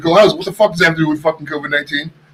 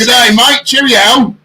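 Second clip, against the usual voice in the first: higher-pitched hammed-up British accent; mock British accent; 5 semitones higher, slower than usual. Host mimicking the co-host's British accent, high and hammed-up. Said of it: "I'm doing a British accent".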